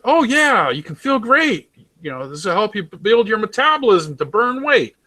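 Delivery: oversell it like a hammed-up commercial host; mock TV ad pitch. Mocking an American vitamin ad, high cheesy pitchman voice. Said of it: "you watch an ad on any kind of".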